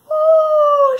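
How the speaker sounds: very high, loud shocked oh; grandma's fright; startled gasp. Acted. Grandma seeing the wolf, high startled oh.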